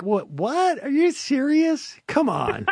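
high, stunned disbelief; incredulous. Retold or imitated. Host mocking the reporter's question, high with disbelief. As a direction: react like you can't believe the question.

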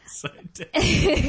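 high, slow squeak on one word; squeaky drawn-out. Retold or imitated. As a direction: stretch it out, high and silly. Mocking the cop's word.

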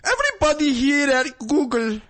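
high, slow put-on Indian accent pep talk; bad impression of a boss. Mock speech to the new Google interns. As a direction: ham up a rousing Google speech. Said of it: "That's my bad impression of him".

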